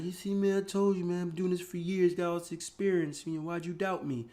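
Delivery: high-pitched parody of a gloating trader; mock excited trader. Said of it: "And they're all excited about".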